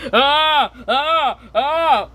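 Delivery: three high, separate "ah" yelps; repeated mock-startled cries. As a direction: yelp at each wrong button. Daddy Pig pressing wrong buttons.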